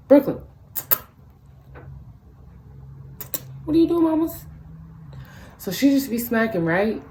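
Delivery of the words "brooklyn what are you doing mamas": high pet voice calling out; calling the dog. Real, not acted. Owner calling her smacking Chihuahua, high pet voice. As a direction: stop the dog mid-smack, pitched up.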